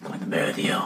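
hushed mock-menacing whisper; muttering. Killer muttering into the phone to a victim, hushed mock menace. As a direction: mutter the threat softly, without voice. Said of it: "muttering in the phone".

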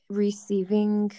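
whispered. Real, not acted. Dwells on the key word.

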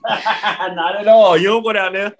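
slow words through real laughter; laughing. Real, not acted. Guest laughing off the Atlanta joke, speaking through laughter. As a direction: laugh it out while teasing back. Laughing while joking back.

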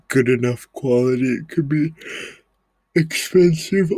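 talking through a yawn; words stretched by a yawn. Real, not acted. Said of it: "Oh, why am I yawning?".

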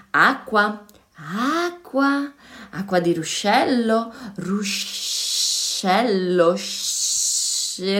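flowing words with long shushing sounds; babbling stream water. A narrator's reading. Stream saying its name to the children, flowing, shushing water voice. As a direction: stretch the sh like running water.

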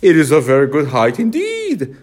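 a touch of an angry caterpillar. A narrator's reading. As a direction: snap it back, offended. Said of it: "said the caterpillar angrily".

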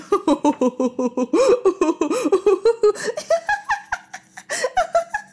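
long high chorus of laughter; crowd of animals laughing. Acted. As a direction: roar with laughter. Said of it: "empezaron a reír".

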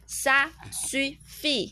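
"ça suffit" very slow, syllable by syllable; slow practice model. Shown as a demonstration. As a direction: stretch out "ça suffit" for a learner to repeat. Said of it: "再练习一次". (慢慢地一个音节一个音节念ça suffit)

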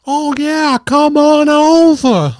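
high put-on child's voice, drawn out; badly faked kid voice, sing it out high and loud; Fester. Fester luring Emily to the house, unconvincing child impersonation. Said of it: "unconvincing voices of other people".

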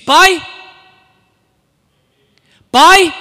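loud, high cry; calling out. Acted. Prodigal son addressing his father before confessing, loud call.

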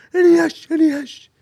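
very high, loud strained asking; imitated deaf traveller. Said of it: "So he was like".

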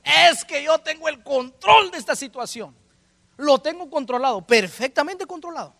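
high, loud insistence; mock the self-assured, belting it out high; overconfident boast. Preacher imitating people who claim control, loud overconfident boast.

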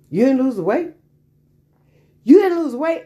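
high, loud, incredulous outburst; doctor going off. Retold or imitated. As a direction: retell the surgeon blowing up, voice shooting up. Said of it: "he went off".

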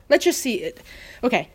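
normal talking voice

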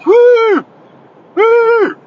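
loud, high-pitched woo; high, gleeful cheer; celebratory whoop. Real, not acted. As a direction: whoop loudly for half term. Celebrating half term news.

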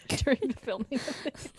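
ordinary delivery

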